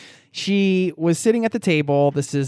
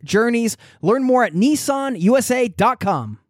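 upbeat sponsor read voice; 6 semitones higher than usual. Acted. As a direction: read it like a podcast ad. Sponsor ad reads.

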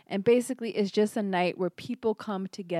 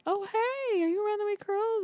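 cheery greeting; 13 semitones higher than usual. Pretend. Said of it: "and be like".

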